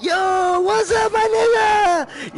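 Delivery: very high, loud greeting in a mock Japanese accent. Japanese dad turns round to greet his son, loud mock Japanese accent. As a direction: imitate a dad shouting hello with a Japanese accent. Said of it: "And the dad turns around".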